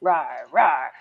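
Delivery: lightly growled low bear rawr. Retold or imitated. Bear gentleman answering the apologizing townspeople, mild growl. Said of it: "he only responded".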